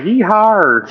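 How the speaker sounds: high, loud drawn-out mimicry of a yeehaw; imitated yeehaw. Teasing a friend over their yeehaw, imitated whoop. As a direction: copy a friend's whoop with comic exaggeration. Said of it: "I like how, uh, Seg did a yeehaw".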